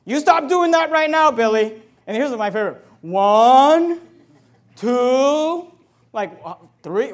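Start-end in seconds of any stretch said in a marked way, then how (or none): 0.0-1.8 loud, higher-pitched mock scolding; exasperated parent. Father telling Billy to stop right now, exasperated. 3.0-7.1 loud, drawn-out warning count; counting-parent threat. Acted. Mocked parent counting at Billy, loud and drawn out. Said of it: "how long are you gonna count for".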